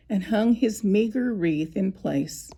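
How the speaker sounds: ordinary delivery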